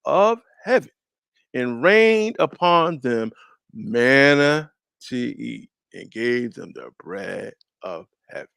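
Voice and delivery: emphatic, drawn-out scripture proclamation; scripture reading. Acted. Preacher reading the verse to his study partner, emphatic scripture reading. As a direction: read it out slowly, stretching key words.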